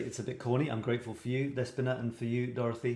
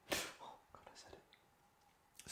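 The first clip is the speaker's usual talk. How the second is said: whispered, much softer; embarrassed whisper; 20 dB quieter, much slower than usual. Real, not acted. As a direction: mutter it to yourself, mortified. Embarrassed aside after confession.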